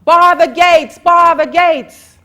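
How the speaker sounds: high, loud panicked yell; guard yelling. A narrator's reading. Guard yells to his men as the hound comes, panicked shout. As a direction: scream the order to shut the gates. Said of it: "the guard yelled".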